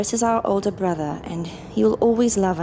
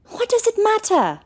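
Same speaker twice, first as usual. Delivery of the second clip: sharply raised, much higher; exasperated shout; 13 semitones higher, 3 dB louder than usual. Acted. Sister snaps back at her brother, exasperated shout. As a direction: snap back at him in frustration.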